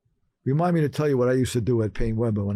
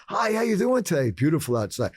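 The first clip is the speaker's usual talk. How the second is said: higher, chirpy opener, nerves hidden; forced cheerful small talk; 7 semitones higher than usual. Retold or imitated. To the teller, put-on cheery small talk. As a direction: act breezy and friendly. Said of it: "not gonna be grumpy".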